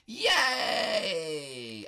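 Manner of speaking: long, high, loud yay; cheering. Pretend. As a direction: let out a drawn-out celebratory cheer. Celebrates getting up the wall.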